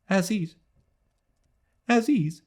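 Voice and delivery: put-on a young woman stunned by a name; dazed repetition. A narrator's reading. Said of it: "She repeated".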